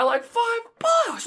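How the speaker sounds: slow, high squeal; mock outrage. Winner at the narrow margin, mock-outraged squeal. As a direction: stretch it high in mock horror. Horror at near miss.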